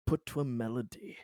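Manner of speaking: normal talking voice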